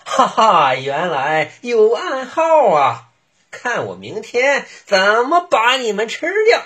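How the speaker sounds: high, laughing wolf voice; a wolf secretly gloating; gleeful. Acted. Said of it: "窃喜".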